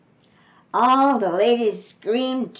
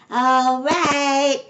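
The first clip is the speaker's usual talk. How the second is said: higher, louder drawn call; 7 semitones higher, 5 dB louder than usual. A narrator's reading. Amelia Bedelia calls to the guests, calling out. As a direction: sing out loud and high. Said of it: "called Amelia Bedelia".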